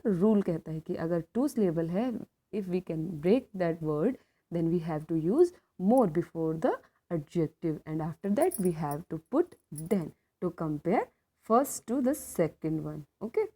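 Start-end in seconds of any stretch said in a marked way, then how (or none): 2.5-13.0 1 slow rule statement. Shown as a demonstration. Teacher states rule in English.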